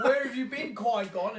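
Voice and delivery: Obi-Wan scolding his late master; mock exasperated Obi-Wan. Obi-Wan voiced to Qui-Gon.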